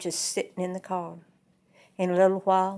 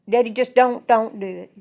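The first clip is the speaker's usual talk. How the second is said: loud pleading; 3 semitones higher, 10 dB louder than usual. Retold or imitated. Daughter begging her father.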